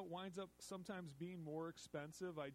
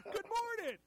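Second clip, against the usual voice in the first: laughing shout; 10 dB louder than usual. Real, not acted. Host shouting greeting laughing.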